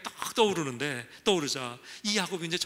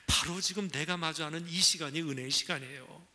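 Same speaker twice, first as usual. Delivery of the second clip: usual speaking voice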